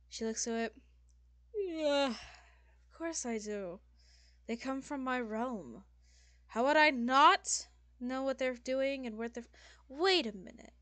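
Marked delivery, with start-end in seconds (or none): 1.5-10.7 loud, higher groan, drawn-out indignant words; haughty, indignant demon lady. Acted. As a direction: boast with exasperation, then catch yourself. Game master voices indignant demon lady.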